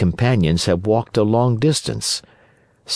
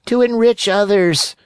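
echoing cat; 14 semitones higher, 4 dB louder than usual. Acted. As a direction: parrot the fox like a cat. Cat echoes.

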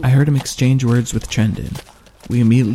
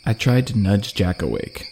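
usual speaking voice

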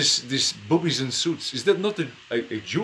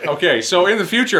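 normal talking voice

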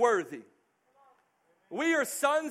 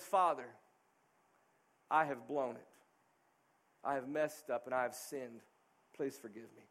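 humble plea; 10 semitones lower, 7 dB quieter than usual. Acted. Prodigal son's humble plea to father.

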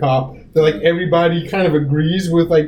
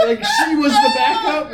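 laughing exclamation; 8 semitones higher, slower than usual. Real, not acted. Laughs at the cop story.

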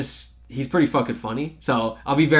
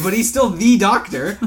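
"the Doctor" slow, higher and louder, "the" hit hard; emphatic THE; 7 semitones higher, 6 dB louder than usual. Real, not acted. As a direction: insist he's THE Doctor. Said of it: "you said it like the Doctor".

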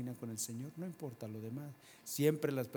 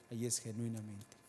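ordinary talk, nothing marked

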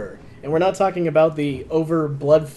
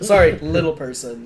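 ordinary delivery

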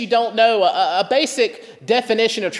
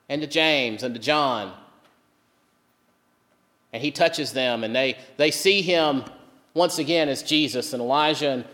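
normal talking voice

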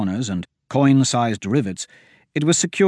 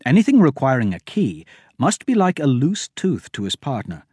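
plain reading voice

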